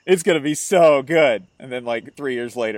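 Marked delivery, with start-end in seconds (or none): 0.0-1.4 mock hyped-up friend. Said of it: "he was like".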